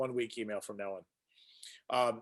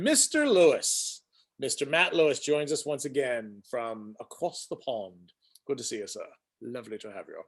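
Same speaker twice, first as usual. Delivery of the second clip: bad English accent. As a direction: welcome him in a badly faked English accent. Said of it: "That's the worst English accent I've ever heard".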